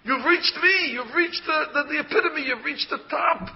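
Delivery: high, excited proclamation; triumphant announcement. Acted. God's answer to Moses, voiced.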